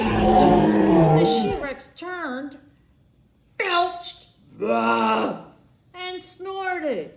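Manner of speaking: loud, slow storyteller boom; dramatic build. A narrator's reading. Narrator roaring the She-Rex's rage to kids. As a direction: boom it out like a monster tale.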